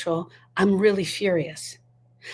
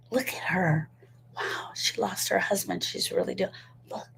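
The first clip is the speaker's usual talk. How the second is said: soft, whispered aside; gossiping whisper; 7 dB quieter than usual. Retold or imitated. Onlookers remarking on a woman who lost her husband, whispered. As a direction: whisper like an onlooker pointing someone out.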